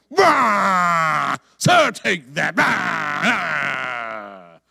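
shouted roaring defiance; full-throated growling shout; ferocious battle roar. Acted. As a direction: roar as you rip the enemy apart. Believer tearing the lion apart.

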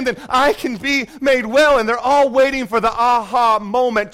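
loud, rising sermon cadence; fervent preaching. Real, not acted. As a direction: preach it out to the whole church. Preaching builds on the pool story.

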